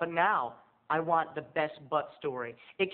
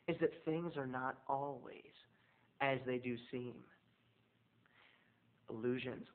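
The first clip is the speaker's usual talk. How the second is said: mock solemn; 4 semitones lower, 10 dB quieter than usual. Mock-profound moral.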